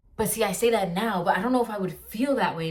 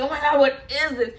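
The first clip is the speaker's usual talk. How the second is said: excited squeal; 7 semitones higher, 6 dB louder than usual. Retold or imitated. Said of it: "I was like".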